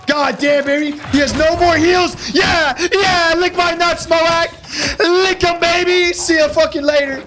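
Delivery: high, triumphant yelling at the beaten foe; real hype after a hard win; gloating victory shout. Real, not acted. Winning the duel, gloating.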